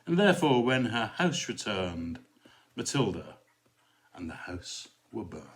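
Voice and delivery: lively, dramatic recitation of a cautionary verse; poem recitation. Acted. Vicar reciting a Belloc poem to viewers, dramatic recitation. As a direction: perform the poem for the audience.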